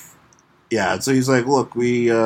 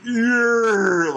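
victory cheer; 8 semitones higher than usual. Retold or imitated. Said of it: "Aquaman's like".

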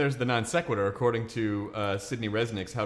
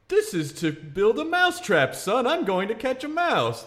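higher, slower, flustered father; flustered dad; 9 semitones higher, slower than usual. Acted. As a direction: stall like a caught father. Father caught, flustered excuse.

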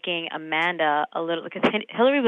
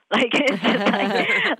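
laughing through the words, slow and higher; 6 semitones higher, 4 dB louder, much slower than usual. Real, not acted. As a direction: giggle it out, drawn out. Laughing at her own joke.

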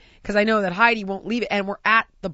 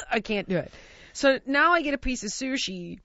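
ordinary talk

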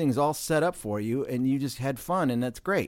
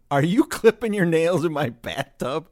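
loud, higher, silly accusation; silly teasing; 5 semitones higher, 8 dB louder than usual. Retold or imitated. Host catching guest at the bathtub, silly mock outrage. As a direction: tease with mock outrage, loudly. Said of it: "in that tone ... I was being silly".

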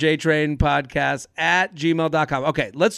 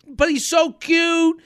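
high, slow, pleading; whiny girly voice; 11 semitones higher, slower than usual. Retold or imitated. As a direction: whine it like a lovesick friend.

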